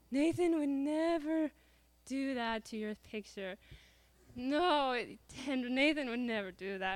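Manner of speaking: drawn-out, lightly imitated motherly assurance; slowly insist, like a protective mom; mom's insistence. Mom defending nephew, acted.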